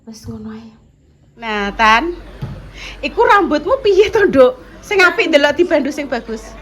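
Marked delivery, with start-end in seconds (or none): 1.4-6.5 loud, high telling-off; scolding mother. Real, not acted. Mother scolding child's hair.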